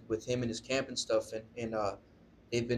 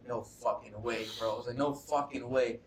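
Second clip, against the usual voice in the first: stunned disbelief. Retold or imitated. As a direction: stretch each word in retold amazement. Retold disbelief at recognising the voice.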